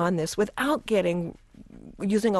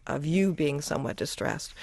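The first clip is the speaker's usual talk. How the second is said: normal talking voice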